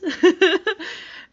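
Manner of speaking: loud high cackle; loud laugh. Real, not acted. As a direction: burst out laughing. Laughs at chicken line.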